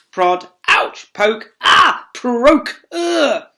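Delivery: put-on high, loud yelps as a child is poked; yelps of pain. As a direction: squeal each time the stick jabs.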